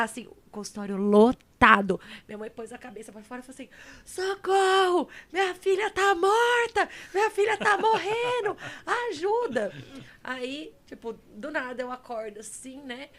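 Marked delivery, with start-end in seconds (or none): 4.0-9.7 hammed-up desperate screaming; imitated mother screaming. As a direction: act out a panicking mother yelling to a crowded room. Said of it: "minha mãe começou a gritar".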